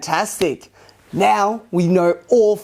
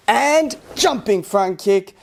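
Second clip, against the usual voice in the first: ordinary delivery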